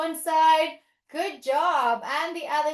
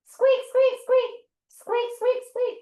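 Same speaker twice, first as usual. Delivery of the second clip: mouse squeaks; 9 semitones higher than usual. Pretend. Teacher being the small forest mouse, high squeaks.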